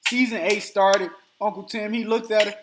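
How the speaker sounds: usual speaking voice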